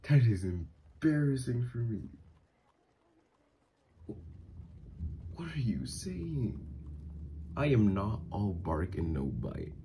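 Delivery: high, agitated and embarrassed; flustered protest. Acted. Flustered as partner undresses nearby.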